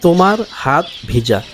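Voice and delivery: ordinary talk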